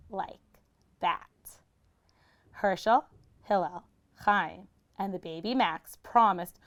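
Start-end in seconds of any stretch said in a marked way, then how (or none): none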